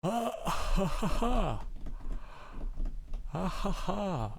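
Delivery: breathless high giggles through a wide-open mouth; helpless gasping laughter mid-exercise; strained laughing. Real, not acted. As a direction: laugh while holding the jaw stretched wide.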